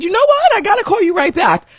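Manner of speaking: urgent need to hang up now; frantic brush-off. Retold or imitated. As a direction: rush her off the phone. Cutting the call off in a panic.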